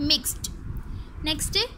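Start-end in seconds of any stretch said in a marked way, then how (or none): 0.0-0.5 "मिक्स्ड" with final t, as mixt; correct t ending. Shown as a demonstration. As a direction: close "मिक्स्ड" on a t sound. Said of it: "correct pronunciation है". ("मिक्स्ड" के अंत में ट)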